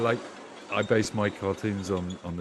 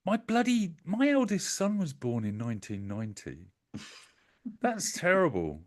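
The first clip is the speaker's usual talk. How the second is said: exasperated; 8 semitones higher, 3 dB louder than usual. Real, not acted. Shocked at age gap.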